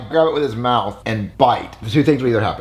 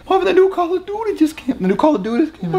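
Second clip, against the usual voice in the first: put-on high whiny kid. Whining kid voice demo.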